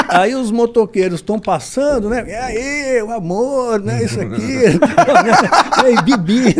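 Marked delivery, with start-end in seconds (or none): 2.3-6.6 high, loud mock cheer with laughs; imitated bikers whooping. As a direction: hype it up like bikers passing by.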